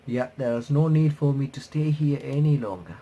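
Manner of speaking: ordinary delivery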